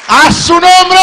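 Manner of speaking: high, loud bellow; devil's shout. Acted. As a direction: roar the claim. Satanás reclama a Jesús. (grito agudo y fuerte del diablo)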